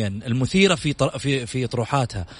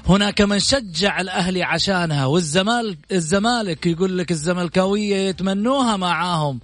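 announcer style; 5 semitones higher than usual. Acted. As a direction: build the guest up grandly. Long musical intro of the guest.

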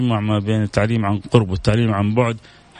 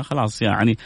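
normal talking voice

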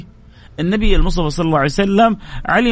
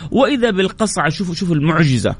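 ordinary delivery

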